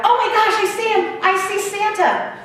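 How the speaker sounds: put-on high, thrilled little-boy cry; excited scream. Little boy spotting Santa, high excited scream. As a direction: shriek it out in delight, high-pitched. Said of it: "another little boy screamed out".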